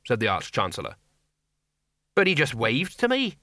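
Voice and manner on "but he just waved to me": higher-pitched, pleading protest; a touch of desperate disbelief; mild desperation. A narrator's reading. Sergeant about the waving corpse, mildly desperate. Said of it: "said the sergeant desperately".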